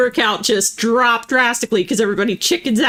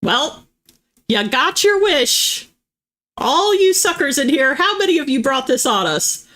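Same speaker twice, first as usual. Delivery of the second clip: much higher, slow, accusing mock indignation; mock outrage; 9 semitones higher, slower than usual. Real, not acted. Streamer teasing chat for landing the Doom, mock-indignant and high. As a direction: play scandalised, scolding the chat.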